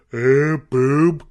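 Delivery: lightly voiced grunting caveman. Cohost mocks men in movies, dim caveman grunt. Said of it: "it is like a Cro-Magnon".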